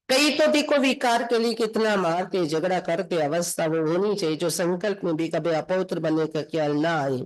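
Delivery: normal talking voice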